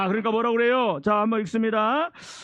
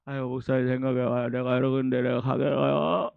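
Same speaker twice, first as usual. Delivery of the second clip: reluctant sick churchgoer; 9 semitones lower than usual. Acted. Sick member making excuses to pastor, reluctant groan. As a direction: drop into a weary, low mumble.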